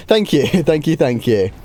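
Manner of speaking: ordinary delivery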